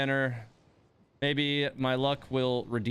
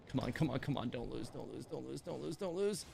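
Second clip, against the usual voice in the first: softer, urgent chant under the breath; muttered plea; 10 dB quieter than usual. Real, not acted. Streamer begging his gacha roll not to lose, in a soft urgent mutter. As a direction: beg the pull, barely voiced. Willing a gacha pull to win.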